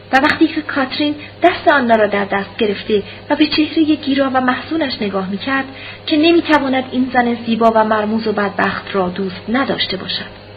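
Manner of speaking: ordinary delivery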